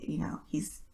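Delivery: normal talking voice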